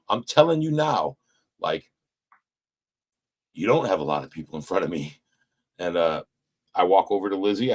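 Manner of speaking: normal talking voice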